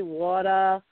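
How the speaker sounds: slow Boston accent, r dropped: "water" like "wahtah", "harbor" like "hahbah". Shown as a demonstration. Host demos the Boston accent to a national audience, in Boston accent. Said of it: "we, we say".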